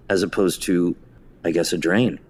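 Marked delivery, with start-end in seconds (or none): none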